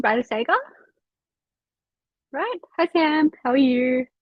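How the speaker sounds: ordinary talk, nothing marked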